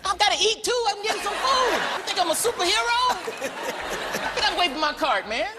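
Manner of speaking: a touch of an exasperated star; his own replies. Retold or imitated. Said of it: "I be like".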